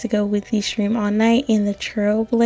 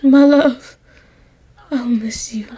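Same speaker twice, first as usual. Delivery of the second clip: hammed-up dying, raised cries fading at the end; acted death scene; 4 semitones higher, 5 dB louder, slower than usual. Dying actor bidding their love farewell, mock-tragic. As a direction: play a poisoned lover's last words, melodramatically. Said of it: "Why do I have to do death scenes?".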